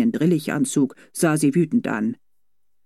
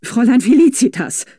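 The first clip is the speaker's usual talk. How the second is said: high, louder warning call of her name; admonishing; 8 semitones higher, 6 dB louder than usual. A narrator's reading. Governess warning her young charge, admonishing call. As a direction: rein her in with a sharp reminder. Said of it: "kam es mahnend".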